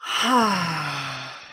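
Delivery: long open-mouthed breath out; deep slow exhale. Shown as a demonstration. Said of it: "just doing it two more times".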